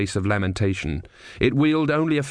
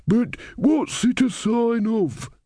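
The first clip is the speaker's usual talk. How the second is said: put-on much higher, slow voice of an old camper; puzzled old man, higher and slowed; elderly man; 13 semitones higher, much slower than usual. A narrator's reading. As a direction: draw out the question, pitched high. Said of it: "said an elderly man who had been camping".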